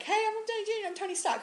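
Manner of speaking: high, cocky mock celebrity intro; mock Robert Downey Junior. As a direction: play a smug star introducing himself. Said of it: "Robert Downey Junior is like".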